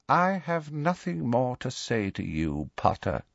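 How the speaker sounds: soft, sinister calm; quietly. A narrator's reading. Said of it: "he said quietly".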